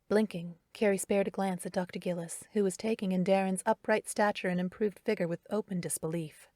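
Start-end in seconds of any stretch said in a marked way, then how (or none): none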